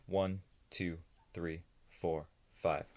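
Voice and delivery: soft, slow breath count. Shown as a demonstration. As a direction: count gently, letting it fade.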